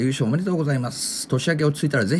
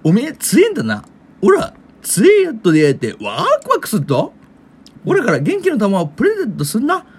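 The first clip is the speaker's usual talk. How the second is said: Goku impression; 5 semitones higher, 4 dB louder than usual. Reading a fan's message as Goku, higher, louder and eager. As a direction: play the excited Goku congratulating a fighter. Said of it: "おら、ロン悟空".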